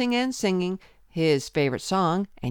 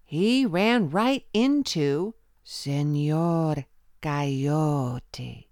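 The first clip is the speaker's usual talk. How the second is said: ordinary delivery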